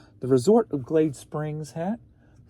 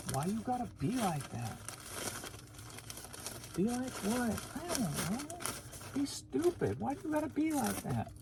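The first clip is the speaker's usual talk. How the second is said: 1 mock high-voiced nagging; 10 semitones higher, 11 dB quieter than usual. Dialogue with self in high voice.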